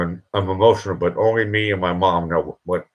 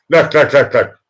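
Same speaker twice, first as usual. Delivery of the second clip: emphatic call; 6 semitones higher, 5 dB louder than usual. Real, not acted. Emphatic repeated calling.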